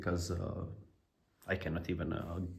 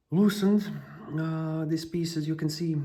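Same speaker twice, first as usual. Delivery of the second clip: strained, higher, louder while bending over; straining through back pain; 9 semitones higher, 4 dB louder than usual. Real, not acted. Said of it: "my back hurts as fucking hell and I cannot really bend".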